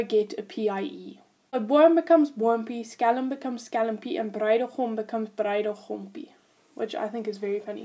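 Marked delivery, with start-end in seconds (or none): none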